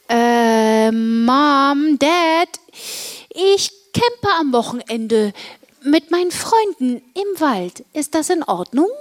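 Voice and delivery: drawn-out "Äh", tentatively rising in pitch; hesitant. Acted. Girl asks her parents to let her camp, hesitant. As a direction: ask haltingly, feeling your way into the request. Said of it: "fragte ich zögernd".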